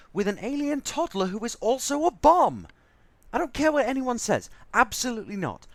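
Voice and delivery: indignant outburst growing into yelling; building angry rant. Acted. Refuses to share space with alien.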